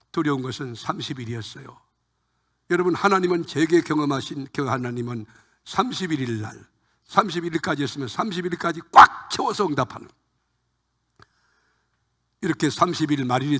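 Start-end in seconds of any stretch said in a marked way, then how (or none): none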